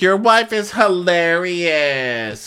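high, slow, drawn-out imitation; exaggerated compliment. Kenya praising Maurizio's wife, exaggerated imitation. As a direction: stretch it out in a high mock gush.

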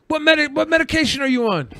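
high, fast mock questioning; imitated prying stranger. Stranger quizzing a customer about meds, imitated prying. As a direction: fire it off high as the nosy stranger. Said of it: "He's like".

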